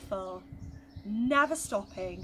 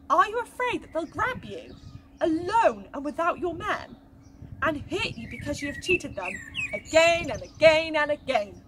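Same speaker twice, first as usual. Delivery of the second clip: put-on lively storytelling for children; story narration; 3 semitones higher, 3 dB louder than usual. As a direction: tell it to the little ones with wonder. Reading a Bible story to children.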